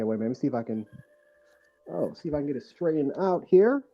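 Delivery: ordinary talk, nothing marked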